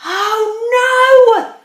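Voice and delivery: loud, high cry of dismay; exasperated Mister Bear. Acted. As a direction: groan it out like a fed-up bear. Fed-up bear exclaims in story.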